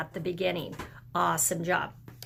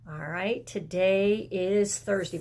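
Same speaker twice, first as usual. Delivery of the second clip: normal talking voice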